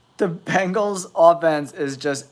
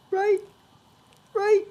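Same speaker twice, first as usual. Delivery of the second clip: very high falsetto, one short squeak; tiny high pet voice; squeaky dog talk; 4 dB quieter than usual. Pretend.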